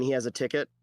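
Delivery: ordinary delivery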